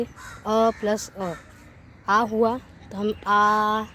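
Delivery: अ plus अ joined slowly into a drawn-out आ; slow classroom demo, अ plus अ becomes आ; long vowel demo. Shown as a demonstration. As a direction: stretch the आ to show it is long. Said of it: "आ में समय ज़्यादा लग रहा है".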